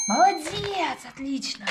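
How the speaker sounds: high, bright praise for a dog; praising the dog. Real, not acted. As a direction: reward the dog with excited praise.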